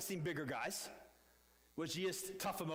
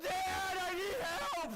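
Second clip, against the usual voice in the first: put-on high, loud frightened boy calling dad; retold panicked yell for dad; panicked cry for help; 11 semitones higher, 4 dB louder, much slower than usual. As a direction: cry out to dad in fear, as a hurt kid. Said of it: "And I let out a scream".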